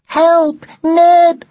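high, loud desperate scream; anguished cry for help. A narrator's reading. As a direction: cry out in terror from a nightmare. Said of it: "raised in anguish".

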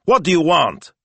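loud, high, indignant demand; barked. A narrator's reading. Boss demanding answers from prank caller, barking. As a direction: bark it loudly at the caller. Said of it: "he barked".

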